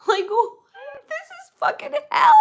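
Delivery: cracking into high laughter mid-rant; laugh-cry the words out in exasperation; laughing in disbelief. Real, not acted. Ranting to her viewers about the state of the world, laughing in disbelief.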